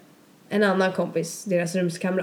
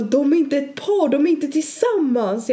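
insistent; 7 semitones higher, 4 dB louder, slower than usual. Retold or imitated. Viktor insisting, retold.

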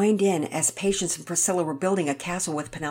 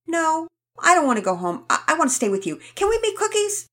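put-on higher, louder childlike asking; eager child; 11 semitones higher, 5 dB louder, faster than usual. Rocket begs the kids to make cookies, eager. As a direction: plead like a little boy.

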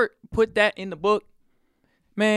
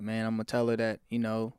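lower, softer retelling of a broken-down dad; subdued retold confession; 5 semitones lower, 6 dB quieter than usual. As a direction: quietly voice a humbled father. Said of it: "it broke Allen down".

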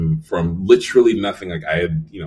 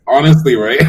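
laughing; 8 semitones higher, 10 dB louder than usual. Real, not acted. Laughing agreement.

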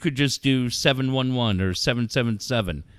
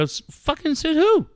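incredulous outburst. Retold or imitated. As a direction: fire back a stunned 'said who'. Retelling his pushback to Bob.

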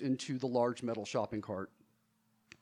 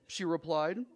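ordinary delivery

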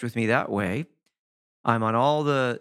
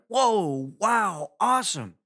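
higher, bright gushing whoa-wow; excited coworker; 8 semitones higher, slower than usual. Retold or imitated. Imitating coworker Paul's enthusiasm, higher excited exclamations. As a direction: gush like everything thrills you. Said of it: "his voice was like this".

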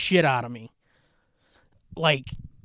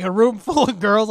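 normal talking voice

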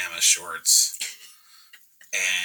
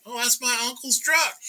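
high-pitched excited local; high, put-on retold exclamation; imitated local.

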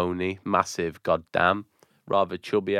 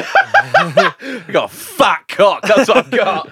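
high, loud laughing brag; 12 semitones higher, 8 dB louder, much slower than usual. Real, not acted. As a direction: laugh through the words. Laughing boast about werewolf result.

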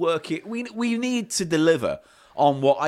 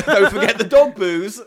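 laughing, louder and higher; 7 semitones higher, 8 dB louder than usual. Real, not acted. Host laughs while reminding co-host about dog booze. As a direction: crack up on the words.

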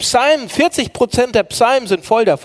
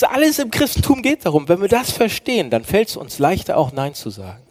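usual speaking voice

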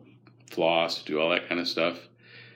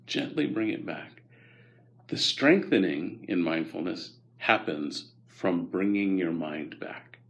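usual speaking voice

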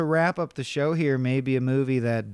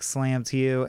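ordinary talk

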